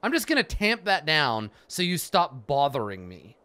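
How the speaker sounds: lightly voiced snooty wizard, loud and sneering; mocking Juno. Player mocking Juno dismissing his worries, sneering. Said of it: "And she's like".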